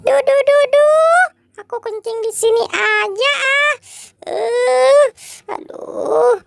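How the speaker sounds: high, squeaky cartoon voice, fretting; a frantic little creature who lost its snack; high squeaky voice, upset and whiny; high-pitched squeaky character.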